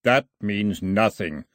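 slow, solemn pronouncement; intoning. A narrator's reading. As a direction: intone it like a decree. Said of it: "Milo intoned".

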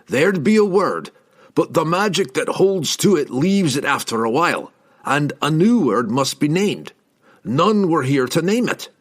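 put-on a proud old dwarf laying it out; dwarf explaining to the elf; stubborn dwarf. A narrator's reading. Dwarf Brunner explains the word.

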